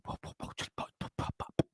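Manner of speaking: whispered mutter under breath; barely audible muttering. Real, not acted. Mutters while checking stats.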